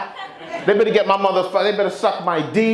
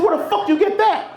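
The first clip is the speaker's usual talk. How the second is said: incredulous dad. Retold or imitated. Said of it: "I said".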